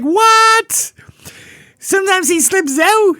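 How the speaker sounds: high, loud, incredulous yelp; shocked disbelief. Retold or imitated. Young narrator hears the sister's warning, shocked high yelp. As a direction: squeal it high in total disbelief. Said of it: "I'm like".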